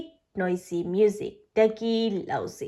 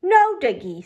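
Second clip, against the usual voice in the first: protest; 5 semitones higher, 6 dB louder, faster than usual. A narrator's reading. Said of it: "says Betty".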